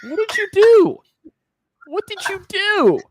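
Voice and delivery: high, loud disbelief; shocked. Real, not acted. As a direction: shout it in shock. Friend choking on beer.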